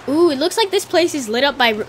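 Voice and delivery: normal talking voice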